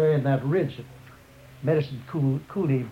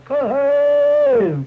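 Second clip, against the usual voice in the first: piercing high battle yell; hollered hey; 11 semitones higher, 10 dB louder than usual. Retold or imitated. Warriors at the river yell the charge signal at full force. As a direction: scream the signal across the river. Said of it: "we hollered".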